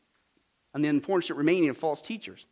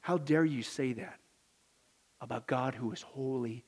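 hushed rebuke; 6 semitones lower, 7 dB quieter than usual. Acted. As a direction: drop to a grave near-hush. Hushed rebuke after loud quote.

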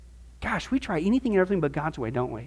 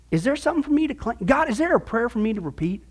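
ordinary talk, nothing marked

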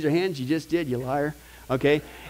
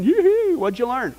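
high, loud gloating cheer that falls flat; mock boastful whoop; 5 semitones higher, 4 dB louder than usual. Preacher mocking Bible-reading braggers, hammed-up whoop. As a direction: crow like a show-off over a record.

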